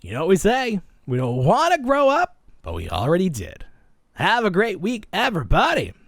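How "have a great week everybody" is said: upbeat sign-off. Real, not acted. Podcast sign-off catchphrase.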